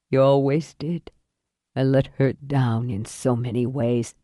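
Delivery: low, weary man's voice; dying father. Acted.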